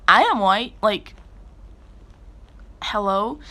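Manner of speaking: loud, offended retort; indignant. Retold or imitated. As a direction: push back loud and offended. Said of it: "And I was like".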